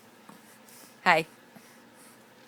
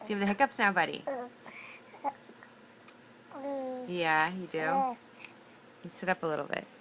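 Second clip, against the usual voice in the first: gentle soothing; 11 dB quieter than usual. Real, not acted. Soothing baby with hiccups.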